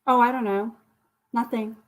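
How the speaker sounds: usual speaking voice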